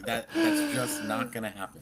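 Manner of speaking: high, loud, laughing dismissal; real laughter breaking into the words. Real, not acted. Speaker scoffing at cheap AI promises, laughing.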